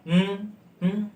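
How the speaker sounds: reluctant closed-mouth hum; unwilling hmm hmm; heavy-hearted hmm. Shown as a demonstration. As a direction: hum a grudging yes. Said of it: "जैसे HMM है ना".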